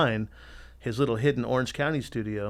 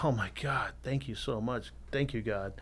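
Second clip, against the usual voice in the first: grateful murmur; 7 dB quieter than usual. Retold or imitated.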